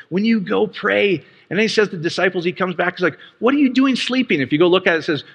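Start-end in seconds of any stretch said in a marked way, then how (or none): 3.4-4.4 higher-pitched, lightly acted reproach; imitated disbelief, pitched up; mock incredulous scolding. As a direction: playfully chide someone caught napping. Jesus rousing sleeping disciples, acted.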